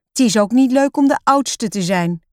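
put-on high, loud, aggrieved child; grumbling. A narrator's reading. Roos telling papa it's hard being oldest, grumbling. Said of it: "moppert Roos".

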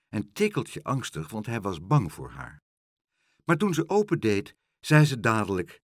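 ordinary delivery

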